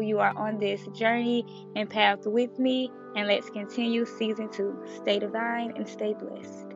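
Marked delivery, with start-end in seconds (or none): none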